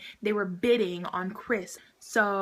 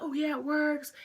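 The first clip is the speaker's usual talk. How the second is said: higher-pitched take on the dad; dad imitation; 6 semitones higher than usual. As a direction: put on the dad's upbeat assurance, pitched up. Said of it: "And the dad's like".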